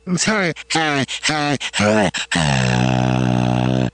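lightly done podcaster impression through a low voice filter; Duncan Trussell. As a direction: greet like a laid-back podcast host. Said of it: "Wait, do Duncan Trussell".

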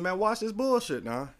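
excited call-out. Retold or imitated. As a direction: come on, watch this. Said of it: "I said".

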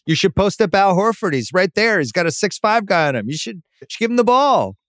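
high, goading sing-song; taunting dare. Pretend. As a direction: needle them into posting up. Voicing the Celtics daring the defense.

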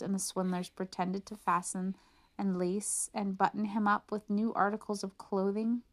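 usual speaking voice

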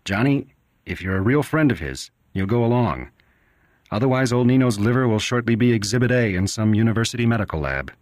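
lightly voiced doctor, offhand and flippant; a doctor making light of a grim case; a hint of a glib, careless doctor. 78 Johnny was offended by the doctor's flippant attitude.

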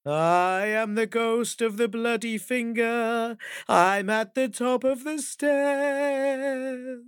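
high slow eerie ghost wail. Acted. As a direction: stretch each word like a ghost. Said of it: "even louder".

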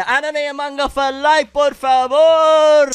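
high, loud, dragged-out mock begging; hammed-up plea. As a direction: stretch por favor out loud and high. Mock Spanish slogan.

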